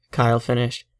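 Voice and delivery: plain reading voice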